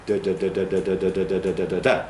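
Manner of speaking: machine-gun run of even "da"s; syllable-timed rhythm. Shown as a demonstration. Teacher shows how syllable-timed languages sound, even level da-da-da. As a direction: chant "da" on one pitch, every beat equal. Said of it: "syllable time languages sound".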